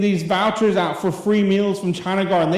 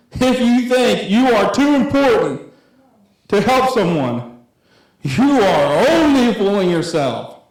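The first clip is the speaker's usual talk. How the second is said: louder, slow, preaching the verse; emphatic scripture reading; 4 semitones higher, 4 dB louder, slower than usual. Acted. Youth pastor pressing the verse on his listeners, slow and loud. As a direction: drive each phrase home slowly.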